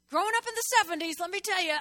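high, loud and fast; excited. Real, not acted. Preacher tells the congregation about the seventies, excited. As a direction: rush it out excitedly.

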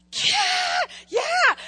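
high, loud yelp of yeah; mock shout. Preacher shouting yeah at the congregation, loud and high. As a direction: shout it out like a cheer.

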